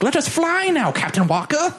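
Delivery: put-on high, small child's voice; a child pleading with his hero; kid voice. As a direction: play the awestruck little kid. Kid in story speaks to pilot.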